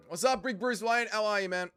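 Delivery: usual speaking voice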